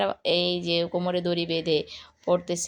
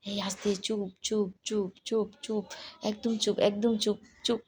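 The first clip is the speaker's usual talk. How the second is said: much softer, hushing the toys; hushed; 5 dB quieter than usual. Pretend. Telling toy people to hush by the dinosaur, much softer. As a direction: keep them quiet so the dinosaur sleeps.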